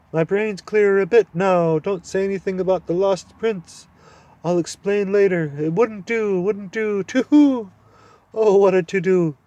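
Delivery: high, loud fluttery owl; fussy owl. Acted. Owl hurriedly warning the children. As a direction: fuss and hoot nervously.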